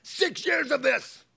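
high, loud, slow angry yell; acted exploding shout; blown-up outburst. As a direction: let years of bottled anger erupt. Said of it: "Have you ever blown up?".

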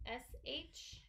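ordinary delivery

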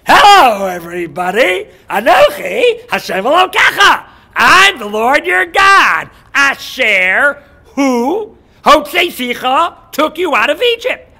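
huge, slow, high-pitched shout; booming announcement, words drawn out; God introducing himself. Acted. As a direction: proclaim it to a whole nation.

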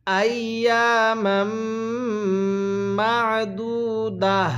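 drawn-out chanted recitation; slow melodic Quranic chant. Shown as a demonstration. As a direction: recite it like a tajweed teacher. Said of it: "কিভাবে পড়ব?".

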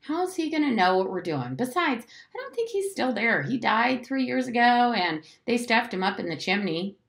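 put-on high, insistent boy arguing; mildly exasperated high protest; hissed impatience. A narrator's reading. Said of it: "Jim hissed".

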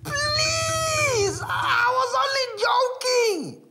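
very high, loud, slow crying plea; tearful shouted plea. Acted. Mongolo begging the baby elephant, loud and tearful. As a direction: beg her, near tears.

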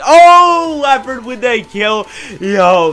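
high, loud drawn-out "oh"; excited shout. Real, not acted. Gamer reacting to leopard's kill, excited shout. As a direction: cry out at a surprise kill.

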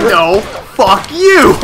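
slow, drawn-out defiance; slow, hammed-up last-gasp retort; mock defiant retort. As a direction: stretch out each word of the imitation. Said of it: "He just bends up at the waist".

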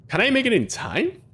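high, anxious question; panicking gamer. Real, not acted. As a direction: panic as the clock runs down. Panicking during timed run.

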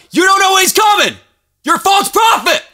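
fiery shout at false prophets; preacher's shout. Real, not acted. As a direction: call them out at full voice. Preacher rebukes date-setters.

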